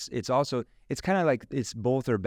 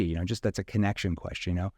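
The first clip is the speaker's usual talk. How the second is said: ordinary talk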